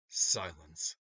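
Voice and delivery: ordinary delivery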